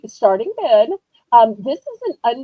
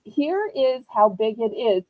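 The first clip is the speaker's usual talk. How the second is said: normal talking voice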